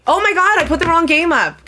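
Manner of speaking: high shocked realization; oh no, wrong game; surprised. Real, not acted.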